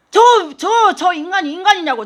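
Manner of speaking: sharp, loud complaint about her husband; a wife venting at God over her husband, loud and indignant; indignant outburst. Retold or imitated. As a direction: blurt it out in a hot burst of anger.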